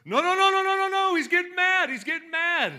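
very high, loud excited yelling; excited Paulie. Retold or imitated. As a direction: scream it like a thrilled cornerman. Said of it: "Paulie's response".

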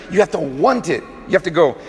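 ordinary talk, nothing marked

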